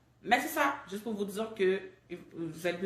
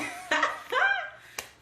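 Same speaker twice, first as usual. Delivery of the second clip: laugh; 6 dB louder than usual. Real, not acted. Laughing after sharing fears.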